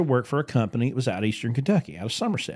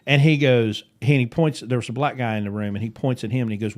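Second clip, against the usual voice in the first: ordinary delivery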